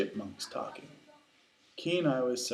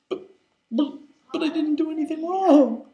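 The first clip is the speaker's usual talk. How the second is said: high, loud, stammering protest; stammer, then wail that you're innocent; shocked protest; 8 dB louder than usual. Acted.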